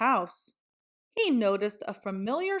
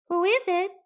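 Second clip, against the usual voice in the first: much higher, squeaky little pig; high piggy voice; 10 semitones higher, 4 dB louder, faster than usual. A narrator's reading. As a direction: call out like a small nervous pig. Pig calls through door.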